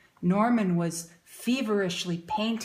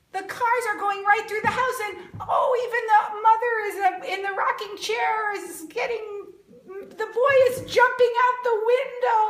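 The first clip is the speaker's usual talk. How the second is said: high, fast, laughing excitement; excited, laughing; 12 semitones higher, 6 dB louder, faster than usual. Acted. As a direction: gush over the picture with a laugh. Excited reading to children.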